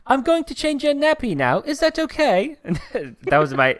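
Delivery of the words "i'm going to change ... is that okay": very high, trashy British accent; a deliberately bad British accent. Shown as a demonstration. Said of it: "that was a British accent there, but that, that was just trash".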